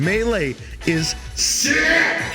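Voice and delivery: slow, high shouted catchphrase; hype shout. Acted. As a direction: yell it like a hype man.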